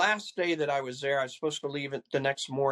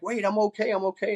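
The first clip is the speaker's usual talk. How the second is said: higher, pleading protest from the dream; panicked pleading; 7 semitones higher than usual. Retold or imitated. Trapped in a closing casket, calling out in panicked protest. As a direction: insist you're alive as the lid closes.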